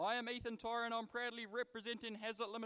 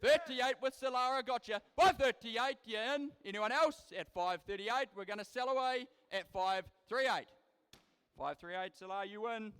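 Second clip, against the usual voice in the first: rapid rhythmic auction chant, punching prices; auctioneer; 3 semitones higher, 9 dB louder than usual. Acted. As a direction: rattle off bids like a livestock auctioneer. Live cattle auction bid calling.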